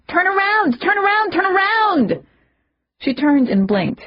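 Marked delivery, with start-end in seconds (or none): 0.1-2.3 high shrieking alarm; shrieked. A narrator's reading. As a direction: scream it in panic. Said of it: "I caught my breath and shrieked".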